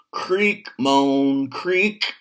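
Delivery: high creaking and moaning bridge sounds; creaky bridge noises.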